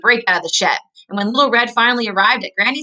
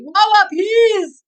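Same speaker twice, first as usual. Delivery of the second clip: burnt-tongue plea; 12 semitones higher, 4 dB louder, much slower than usual. Acted. Coyote begs for water, mouth burning.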